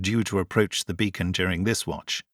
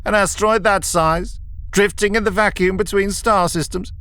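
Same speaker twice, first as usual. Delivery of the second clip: lofty professor; 9 semitones higher than usual. A narrator's reading. Kelman lecturing.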